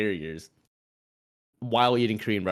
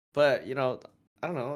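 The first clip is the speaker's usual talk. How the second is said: ordinary delivery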